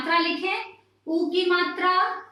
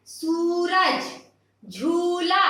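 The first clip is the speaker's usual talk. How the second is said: सूरज, झूला with the first u stretched long; drawn-out long vowel; much slower than usual. Shown as a demonstration. Teacher showing children the long u, first vowel held long. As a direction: lean on the u of Suraj and झूला, dragging it out. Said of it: "खींच के बोलते हैं".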